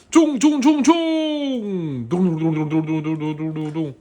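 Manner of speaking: stomp it out like a charging rhino; charging rhino noises. Acted. Rhino charging sound effects.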